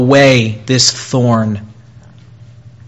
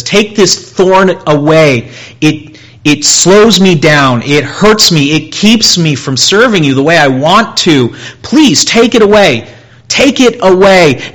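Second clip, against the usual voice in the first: desperate pleading; 6 semitones higher, 7 dB louder than usual. Acted. Preacher voices Paul begging God for relief, high and loud. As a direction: beg God to take the thorn away.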